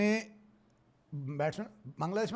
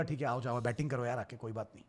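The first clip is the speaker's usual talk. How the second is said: lower, softer making peace; calm reassurance; 8 semitones lower, 9 dB quieter than usual. Retold or imitated. Dhoni voiced calling the batsman back, lower and softer. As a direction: soothe the other man. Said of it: "Dhoni ने बोला".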